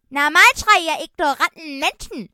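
a talking cake yelling at the humans; shouting cake. Acted. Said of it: "rief es".

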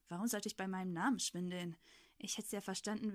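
ordinary delivery, nothing marked

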